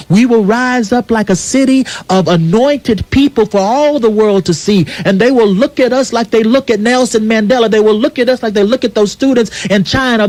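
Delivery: full-force sermon delivery; preaching. Acted. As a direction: preach it to the congregation, swelling. Impassioned speech on radio show.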